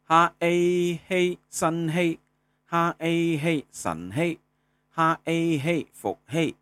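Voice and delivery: 哈A spelled slowly, then 嘿 in high level tone; sounding out words. Shown as a demonstration. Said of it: "第一声".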